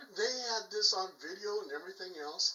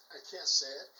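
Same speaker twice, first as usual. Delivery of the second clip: normal talking voice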